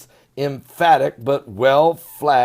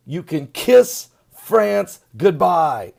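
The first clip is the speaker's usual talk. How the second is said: ordinary talk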